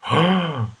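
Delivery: sharp, loud gasp of delight. Real, not acted. Asked about Eurovision, a delighted gasp. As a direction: gasp as if a favourite topic just came up.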